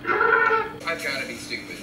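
ordinary talk

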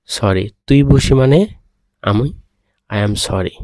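ordinary talk

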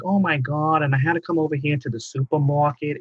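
a touch of Long Island whine; light mock whine stretching supermarket; whiny Long Island accent. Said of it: "a little bit of a whine".

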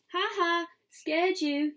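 high, teasing "ha ha"; chuckling. A narrator's reading. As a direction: gloat playfully after a prank. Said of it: "he chuckles".

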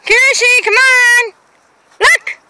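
high, loud call to the dog. Real, not acted. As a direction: call her over. Calling the dog.

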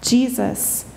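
ordinary talk, nothing marked

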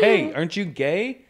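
high, loud, drawn-out friendly question; casual name call. Retold or imitated. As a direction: call out to someone by name, re-enacted. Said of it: "that's how I say their names".

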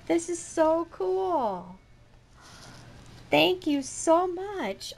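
high, stretched-out exclamations of delight; amazed delight. Real, not acted. Vlogger thanking relatives for a surprise gift, amazed and delighted. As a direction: gush in amazement, drawing out the praise.

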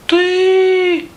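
very high, tiny squeak; sheepish little mice. A narrator's reading. Mice admit the rooster baked, tiny sheepish squeak. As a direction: squeak a small, guilty answer. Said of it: "вже зовсім нишечком кажуть мишенята".

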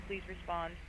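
ordinary talk, nothing marked

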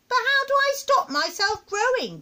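put-on high, loud child voice; high-pitched boyish question; puzzled little boy. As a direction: ask like an exasperated small boy.

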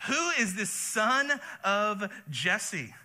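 very slow, sneering drawl of a question; drawn-out scorn. Acted. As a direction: stretch out every word to belittle him.

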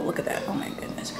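soft unvoiced whisper; whispered aside. Real, not acted. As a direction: breathe it out in a whisper. Whispering at the food.

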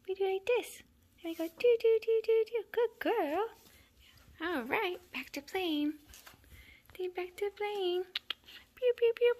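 high, loud squeaky play voice; playful pet talk. Real, not acted. Playing toy games with kittens, high pet talk.

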